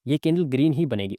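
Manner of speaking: ordinary talk, nothing marked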